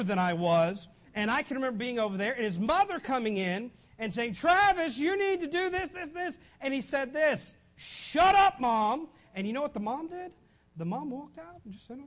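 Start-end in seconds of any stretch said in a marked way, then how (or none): 4.3-6.4 high-pitched mother giving orders; nagging mother. Acted. Neighbour mother giving orders, voiced. 7.8-9.1 high, loud mock yell; bratty back-talk. Boy talking back to mother.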